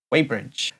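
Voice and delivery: Wadebridge run together, low and soft; quiet correction. Shown as a demonstration. Host quietly correcting Doc Martin. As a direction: mutter the right Wadebridge. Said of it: "he said".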